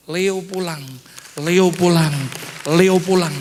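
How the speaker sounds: imitated summons, building to loud; calling voice. Retold voice calling three times. (suara panggilan tiruan yang makin keras)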